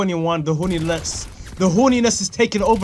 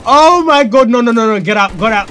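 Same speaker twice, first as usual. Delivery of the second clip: loud, high, frantic shout; panicked yelling; 6 semitones higher, 10 dB louder than usual. Real, not acted. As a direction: yell in panic to escape. Panicking as he is attacked.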